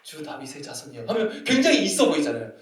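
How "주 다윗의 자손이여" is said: soft, low, composed murmur; casual over tea. Retold or imitated. Woman imagined having tea with Jesus, softly composed. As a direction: say it quietly, as if sipping tea. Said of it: "갑자기 차 한잔하면서".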